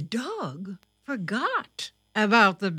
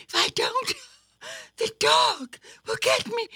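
very high, frightened self-talk; panicked rabbit; faster than usual. A narrator's reading. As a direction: gasp out your fear mid-chase. Thumper fleeing from the dog.

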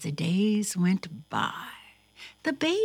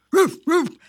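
two loud, high barks; barking dog; 10 semitones higher, 10 dB louder than usual. A narrator's reading. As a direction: imitate a dog's sharp bark.

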